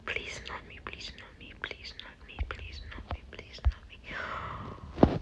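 barely audible, repeated whisper; whispered pleading. Real, not acted. Player awaiting the vote, whispering pleas. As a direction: whisper it over and over like a wish.

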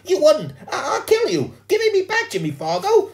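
put-on shrieking girl, very high and loud; furious scream. A narrator's reading. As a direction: scream threats at the boy who took your work. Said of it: "Sheila screamed".

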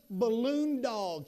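slow, stretched-out announcement; drawn-out reveal. Retold or imitated. Son presents his creation to dad, slow drawn-out reveal. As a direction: draw each word out for the big reveal.

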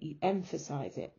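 ordinary delivery

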